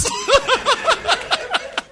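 long burst of high laughter; high laughing fit. Real, not acted. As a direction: burst out laughing after the punchline. Laughs after goat punchline.

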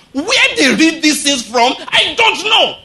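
fervent pulpit preaching; preaching at full force. Acted. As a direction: preach it out to the whole hall.

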